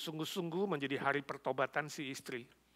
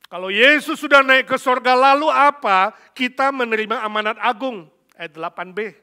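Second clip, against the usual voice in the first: loud, high proclamation of scripture; full-force preaching; 8 semitones higher, 19 dB louder than usual. Real, not acted. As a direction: proclaim it to the whole congregation. (khotbah lantang, menyerukan firman)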